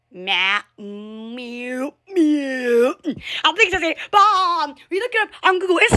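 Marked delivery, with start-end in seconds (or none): 0.1-2.9 drawn-out mews climbing from a low start; low meow, then stretched mews; imitated cat meow. Imitating for viewers what people over there say, drawn-out meowing. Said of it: "People over there actually say meow".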